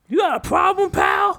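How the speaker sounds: squeaky wannabe tough guy; cocky nervous street slang. Pretend. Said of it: "he'd probably come up to you then".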